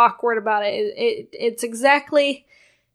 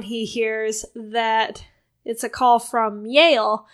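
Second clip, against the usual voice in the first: normal talking voice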